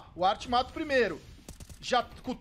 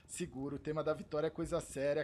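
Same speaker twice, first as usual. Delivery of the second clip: lower, softer, quick mock warning; mock-serious; 9 semitones lower, 10 dB quieter, faster than usual. Real, not acted. Streamer tells co-caster to hold the victory song, mock-serious. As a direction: keep it hushed like a serious rule.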